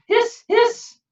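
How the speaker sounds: high whistling hiss; kettle hiss. A narrator's reading. Kettle sound.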